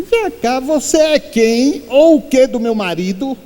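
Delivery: high, sharp challenge from the widow; a widow confronts the crying stranger at the wake; confronting widow. Retold or imitated. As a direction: demand who she is to your husband.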